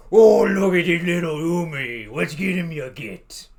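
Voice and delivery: loud, high Cockney orc shout. Retold or imitated. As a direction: bellow it like a Cockney brute. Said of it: "They speak in Cockney accent".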